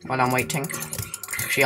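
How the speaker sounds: ordinary talk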